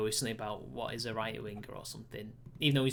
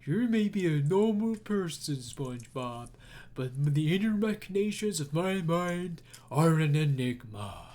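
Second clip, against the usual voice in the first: cartoon Patrick voice; 5 dB louder than usual. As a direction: deliver it like a dim cartoon sage. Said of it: "My other favourite quote is".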